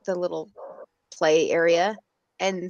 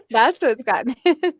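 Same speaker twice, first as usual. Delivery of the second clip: talking through laughter, pitched higher; laughing; 6 semitones higher, 5 dB louder than usual. Real, not acted. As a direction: laugh your way through the words. Laughing at her own story.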